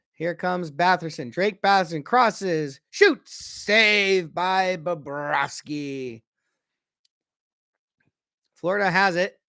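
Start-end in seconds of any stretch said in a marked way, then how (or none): 2.9-6.2 real play-by-play excitement; excited save call. Real, not acted. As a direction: punch the shot, then stretch out the save. Calling shot and save.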